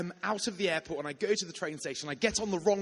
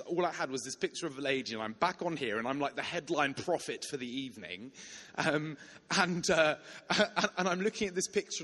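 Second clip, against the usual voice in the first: ordinary delivery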